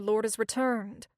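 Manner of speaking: ordinary delivery, nothing marked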